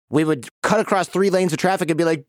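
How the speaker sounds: usual speaking voice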